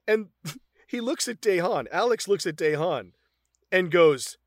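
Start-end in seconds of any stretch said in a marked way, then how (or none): none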